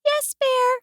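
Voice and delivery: high, small resigned squeak; sighing mouse. A narrator's reading. Mouse gives in to Bear, high resigned sigh. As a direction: give in as a tiny mouse. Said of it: "sighed Mouse".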